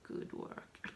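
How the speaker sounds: whispered through a laugh; amused whispered praise. Real, not acted. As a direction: breathe it out giggling. Jokes about hour-long haul.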